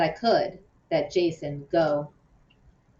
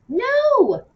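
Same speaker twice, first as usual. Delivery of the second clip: high, loud alarmed no; snatch it back with a cry; panicked NO; sharp no; 6 dB louder than usual. A narrator's reading. Lupe yanks flyer away.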